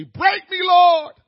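high, loud shout to God; cry of surrender. Pretend. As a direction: call out to the Lord, full voice. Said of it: "Somebody need to say".

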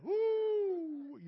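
long, high, loud whoop; mimicking an ecstatic church holler; imitated worship whoop. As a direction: let out a drawn-out "whoo".

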